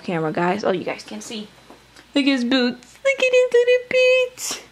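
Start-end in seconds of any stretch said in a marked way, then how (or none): 3.0-4.6 put-on much higher, louder cutesy baby talk; cooing baby talk. Mom showing her doll's boots to viewers, high baby talk. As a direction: coo over the tiny boots like a baby.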